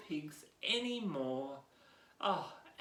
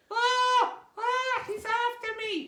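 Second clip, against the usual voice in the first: very high, loud squeals; a piglet screaming for its life; panicked pig scream; 9 dB louder than usual. Acted. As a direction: shriek as if chased. Pig fleeing the wolf.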